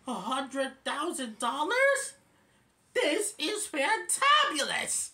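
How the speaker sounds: slow, high, loud excited disbelief; Alvin. Retold or imitated. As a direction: crow over the prize in a high chipmunk voice. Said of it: "And then Alvin says".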